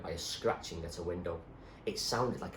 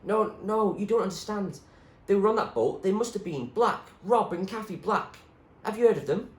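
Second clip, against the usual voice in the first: put-on higher, louder urgent girl; pleading girl; 13 semitones higher, 7 dB louder than usual. Zoe begging the doctor to remember, higher urgent voice. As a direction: insist desperately as Zoe.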